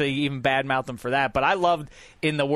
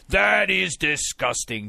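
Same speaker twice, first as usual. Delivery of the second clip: slow, pious announcer voice imitating Joe Buck; drawn out like a preachy broadcaster; mock Joe Buck; slower than usual. Mocking Joe Buck's disgusted call on air. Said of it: "Joe Buck declared".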